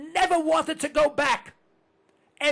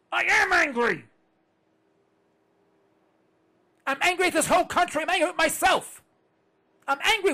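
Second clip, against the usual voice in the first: loud, higher shout of anger; angry shout; 6 semitones higher, 5 dB louder than usual. Real, not acted. Host declaring his anger to listeners. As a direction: let the anger explode. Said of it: "Do I sound angry?".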